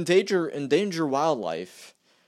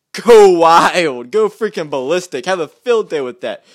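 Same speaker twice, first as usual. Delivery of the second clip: emphatic louder rant; indignant raised voice; 12 dB louder than usual. Real, not acted. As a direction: hammer it home, exasperated. Ranting about Democrats' reaction.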